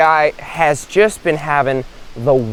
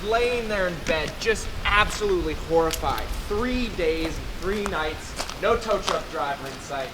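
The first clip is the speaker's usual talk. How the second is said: soft, suspenseful storytelling; hushed story narration; 2 semitones higher, 11 dB quieter than usual. A narrator's reading. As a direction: tell it in a haunted hush.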